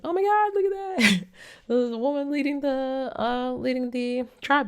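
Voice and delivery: very high, thrilled and gushing; mock fangirl squeal, pitched far up; delighted squeal. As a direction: gush about it way up in falsetto. Speaker excited woman leads tribe.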